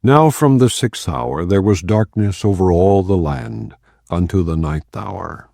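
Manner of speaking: usual speaking voice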